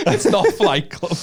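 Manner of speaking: high, slowed, laughing repeat of "It's not Flight Club"; laughing mock quote; laughing echo. Repeats friend's line in laughing voice.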